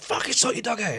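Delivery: imitated pub-row complaint; mock angry telling-off. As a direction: act out an annoyed dog owner, without shouting. Retold row between dog owners.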